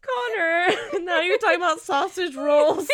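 high, loud exclamation through laughter; cracking up while scolding; helpless laughter. Real, not acted. Reader to co-host after innuendo, exclaiming through laughter.

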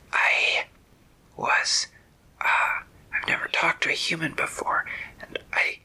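shy whispery snake. Acted. Green snake telling Terry it wants to visit again, in a hesitant whispery hiss. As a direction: confide it in a gentle snake hiss.

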